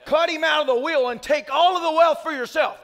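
preaching that builds to a shout; mock preacher. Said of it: "imagine if you came to church and my message was".

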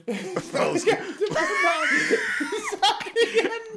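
high helpless laughing fit; burst of laughter. Real, not acted. Host laughing at the joke, helpless laughter. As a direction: crack up uncontrollably.

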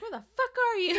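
loud, high hammed-up shout, half laughing; mock angry yell. Co-host joins in as angry Alex at the door, mock yell. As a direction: playfully holler it as if pounding a door. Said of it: "beats down the door and is like".

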